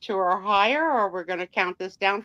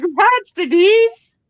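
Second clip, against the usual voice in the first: high, loud cheer; 10 semitones higher, 9 dB louder, slower than usual. Real, not acted. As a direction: cheer the winner on. Cheering the winner.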